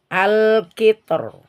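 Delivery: slow al-kitor; al-kitor drawn out; careful slow al-kitor; heavy or light r. Shown as a demonstration. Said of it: "bisa dibaca tebal".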